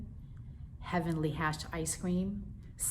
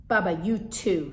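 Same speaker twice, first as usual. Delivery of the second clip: louder sharp rebuke; mother snapping; 7 dB louder than usual. Acted. Mother snapping at baby brother, louder. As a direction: snap at the toddler. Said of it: "snapped my mother".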